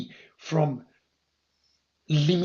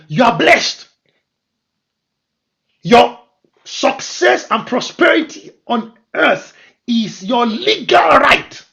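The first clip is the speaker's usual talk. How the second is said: loud, high shouted preaching; preaching at full force; 8 semitones higher, 13 dB louder than usual. Acted. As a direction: thunder it at the congregation.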